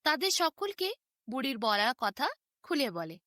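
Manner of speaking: usual speaking voice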